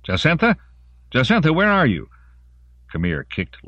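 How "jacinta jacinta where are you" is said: high, loud searching call. A narrator's reading. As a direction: call anxiously for a missing child. Father searching for his daughter.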